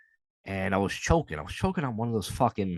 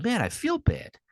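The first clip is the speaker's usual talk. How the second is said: mock hurt; 13 semitones higher than usual. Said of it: "I was like".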